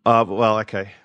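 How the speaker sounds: usual speaking voice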